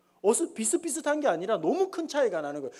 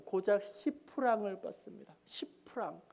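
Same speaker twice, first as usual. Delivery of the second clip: soft, sighing beggar's reply; acted hushed lament; sad, downcast; 8 dB quieter than usual. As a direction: confess it quietly, voice sinking away. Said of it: "아주 슬픈 표정을 지으면서".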